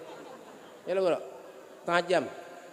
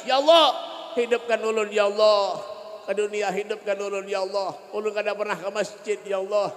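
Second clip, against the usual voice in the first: pleading dead man. Acted. Dead sinner begs to be revived.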